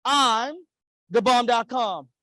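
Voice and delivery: emphatic call for the crowd to echo; like you mean it. Shown as a demonstration. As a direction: lead the audience in a punchy affirmation. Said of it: "Say it like you mean it".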